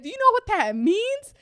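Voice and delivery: very high, loud imitated alarm; hammed-up incredulous mom; mom freaking out. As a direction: mimic a panicking mom's shrieky question. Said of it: "she was freaking out".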